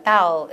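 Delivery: low 'thou', tongue retreating after the vowel; tongue pulled back. Shown as a demonstration. Teacher explaining foreigners' sound, thou with retracted tongue. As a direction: pull the tongue back fast at the end of thou. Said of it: "얼른 뒤로 가져가는 거".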